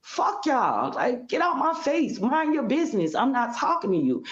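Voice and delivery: high, heated outburst near tears; retold angry outburst. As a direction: lash out at them all. Retold blow-up at in-laws.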